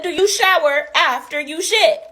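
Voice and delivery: deadpan slow jab; slow pointed. Retold or imitated. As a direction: spell the question out slowly. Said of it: "I said".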